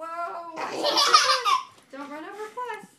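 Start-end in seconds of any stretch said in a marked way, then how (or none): 0.5-1.6 slow, loud, laughing warning. Real, not acted. Toddler chasing the cat.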